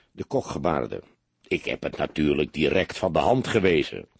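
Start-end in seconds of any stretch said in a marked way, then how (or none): 1.5-4.1 low, understated indignation; indignant. A narrator's reading. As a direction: deny it with contained indignation, pitched down. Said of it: "In zijn stem trilde pure verontwaardiging". (ingehouden verontwaardigd, laag)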